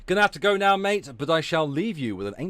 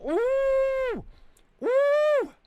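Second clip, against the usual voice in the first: very high falsetto "ooh"; two long, piercing oohs; onlooker's high-pitched ooh at a catfight; scandalized oohs. Retold or imitated. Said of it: "I'm like".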